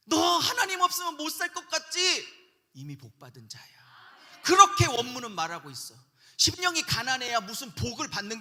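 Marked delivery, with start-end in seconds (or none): 0.1-2.3 pressing question to believer. Acted. Acts out God addressing the needy. 2.7-3.6 low, whispered pronouncement; hushed assurance. Acted. Hushed answer after raised question.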